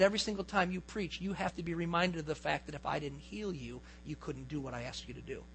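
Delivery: gentle divine reminder. Retold or imitated. The Lord reminding the pastor of his healing, low soft reminder. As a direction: remind someone quietly and patiently.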